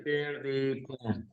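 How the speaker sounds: faint, dragged-out glitchy audio; slow smeared words over bad line; audio cutting out. Real, not acted. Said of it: "Your audio is cutting out".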